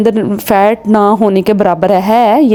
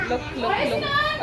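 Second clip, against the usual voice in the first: playful showing off; 13 dB quieter than usual. Real, not acted. Showing off her rings playfully.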